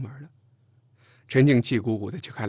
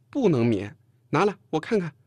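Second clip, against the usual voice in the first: louder, insistent demand; firmer tone; 5 semitones higher, 5 dB louder than usual. A narrator's reading. Chen Jing insisting on a look, firmer tone. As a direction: press the demand with more force. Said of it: "陈静语气重了一些".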